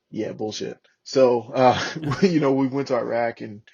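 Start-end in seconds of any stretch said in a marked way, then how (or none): none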